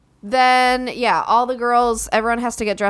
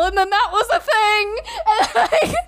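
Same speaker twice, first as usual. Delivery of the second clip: high laugh breaking into the words; laughing through tears; 12 semitones higher than usual. Real, not acted. Bride telling friends about dad sobbing, high teary laugh. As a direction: laugh it out, half crying.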